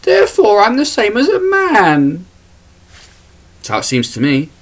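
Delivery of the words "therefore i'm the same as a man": very high put-on mocking voice; mocking women's voice. Man mocking women claiming sameness with men, high parody voice. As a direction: parody a woman in an exaggerated high pitch. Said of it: "because women are the same as men".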